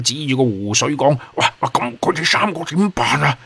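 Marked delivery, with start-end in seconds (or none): 1.4-3.4 high-pitched, panicky stammer; stunned alarm. A narrator's reading. Tofu pointing at the lake, alarmed for the three. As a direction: blurt it out aghast in a higher voice. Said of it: "傻咗眼".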